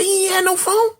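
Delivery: high, incredulous protest; a cellmate's stunned high denial; shocked denial. Retold or imitated. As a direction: refuse to believe it. Disbelief at guard's claim.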